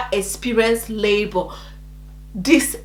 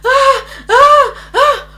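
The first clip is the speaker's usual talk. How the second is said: high pained "ah", repeated; re-enacted screams; 13 semitones higher, 5 dB louder, slower than usual. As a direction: cry out in rhythmic acted agony. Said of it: "I was screaming".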